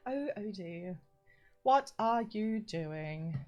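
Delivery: slow, weary disappointment, mildly put on; disappointed voice. Streamer mimicking the text-to-speech voice to Odie, disappointed. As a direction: imitate a let-down text-to-speech woman. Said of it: "a disappointed voice as well".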